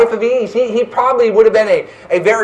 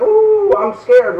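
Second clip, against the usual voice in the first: mock scared; 8 semitones higher, much slower than usual. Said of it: "we're like".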